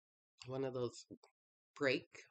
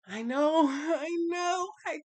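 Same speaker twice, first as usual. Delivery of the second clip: loud, high, laughing protest; laughing exasperated shout; 10 semitones higher, 11 dB louder than usual. Retold or imitated. Shouting back at the reader, half laughing. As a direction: throw up your hands and shout it, laughing.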